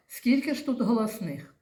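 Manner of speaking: ordinary talk, nothing marked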